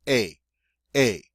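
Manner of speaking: A said as a long ay vowel; long A sound. Shown as a demonstration. Teacher showing the é sound. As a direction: stretch the A into ay. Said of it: "it has the long A sound".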